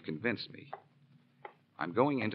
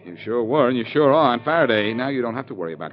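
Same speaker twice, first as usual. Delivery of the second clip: raised, pointed jabs at Faraday; loud taunting; 8 dB louder than usual. Acted. Blackie loudly needling Faraday about the murder, louder. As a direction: push it louder with each jab.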